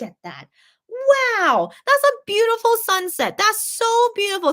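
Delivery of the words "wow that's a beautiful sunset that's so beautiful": delighted awe. Real, not acted. Admiring a sunset photo from chat.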